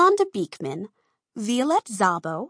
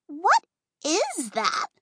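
slow, higher, disgusted question; 7 semitones higher, slower than usual. A narrator's reading. Rita eyeing the smeared dress, slow disgusted question. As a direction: recoil at the mess, slowly. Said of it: "Rita asked with disgust".

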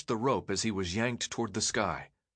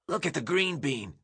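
higher scratchy taunting voice; 5 semitones higher than usual. A narrator's reading. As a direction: scratch out a sneering jeer. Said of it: "a scratchy voice said".

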